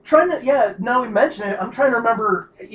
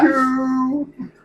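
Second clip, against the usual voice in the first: high, slow, muffled villain taunt; drawn-out taunt inside a helmet; Dark Helmet; 5 semitones higher, much slower than usual. Retold or imitated. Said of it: "Gotta say it like Dark Helmet".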